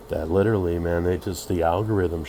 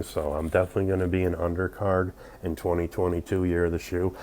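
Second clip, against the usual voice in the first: ordinary talk, nothing marked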